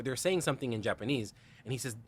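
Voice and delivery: usual speaking voice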